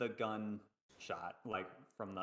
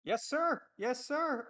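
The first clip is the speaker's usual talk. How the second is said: alarmed compliance; 13 semitones higher, slower than usual. Acted. Player answers armed guard's shouted order.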